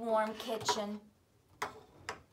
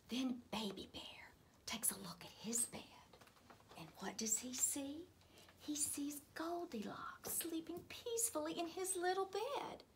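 suspense whisper; 6 semitones higher, 8 dB quieter than usual. A narrator's reading.

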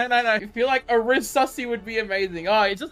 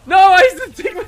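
loud cry breaking into laughter; laughing shout; 10 semitones higher, 11 dB louder, much faster than usual. Real, not acted. Gamer laughing at healing the wrong enemy, loud laughing cry. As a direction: yell it through a laugh.

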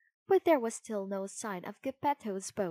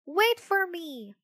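high, slow cry after them; 9 semitones higher, 5 dB louder than usual. Acted. Pinocchio running after fox and cat, high cry. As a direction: call after them, pleading. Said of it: "he cried".

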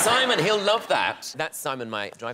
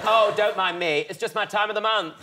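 camp quip; 8 semitones higher than usual. Retold or imitated. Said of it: "he went".

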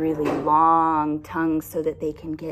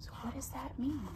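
whispered question; 6 semitones higher, 13 dB quieter, faster than usual. Real, not acted. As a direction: ask it in a hush. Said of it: "whispered question to children".

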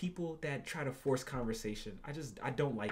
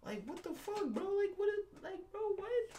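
high, squeaky disbelief; incredulous squeak. Real, not acted. Baffled by the date's question, high disbelieving squeak.

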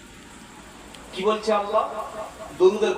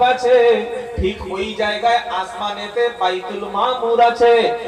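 preaching; 5 semitones higher, 7 dB louder than usual. Acted. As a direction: proclaim it to the crowd. Preaching at full force.

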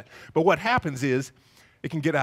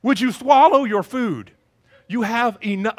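exasperated dad; 5 semitones higher, 8 dB louder than usual. Retold or imitated. Dad telling stuffed toddler to swallow, mock exasperated. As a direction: play a father at his wits' end.

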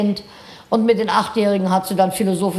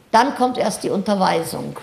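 ordinary talk, nothing marked

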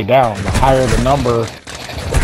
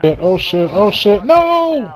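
rising panic, ending in a high yell; yelling as he goes down; panicked shout; 9 semitones higher, slower than usual. Real, not acted. Player getting downed in game.

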